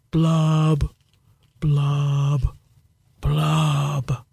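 slow sad fish bubbles; underwater blub blub. Acted. Fish ends its refrain.

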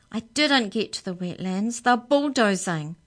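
Amy erupts about the bulldozers; upset. Acted.

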